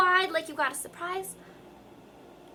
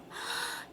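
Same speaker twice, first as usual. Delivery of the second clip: deep breath in; 12 dB quieter than usual. Shown as a demonstration. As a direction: breathe in deeply before speaking. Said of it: "Take a deep breath".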